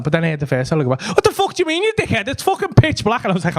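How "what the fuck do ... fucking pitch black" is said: high, irate comeback; angry retort. Retold or imitated. First fella answers the party excuse, angry retort. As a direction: throw the excuse back, outraged.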